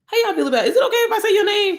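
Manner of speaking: mock timid permission request; imitating a polite ask. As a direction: ask permission sweetly with a giggle.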